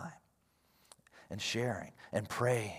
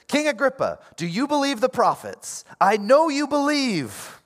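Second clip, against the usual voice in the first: high, loud impassioned appeal; bold direct challenge; 10 dB louder than usual. Acted. Paul confronting King Agrippa about the prophets, bold challenge. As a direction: go right in at the king, stretching the last words. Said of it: "then he goes right in".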